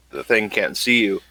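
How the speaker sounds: usual speaking voice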